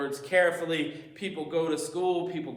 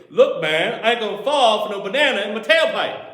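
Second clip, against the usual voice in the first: louder, fast and punchy; more passion; 4 semitones higher, 8 dB louder than usual. Retold or imitated. Imitating Axel Foley acting out the tailpipe line with more passion. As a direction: hype it up with swagger. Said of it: "say it with more passion".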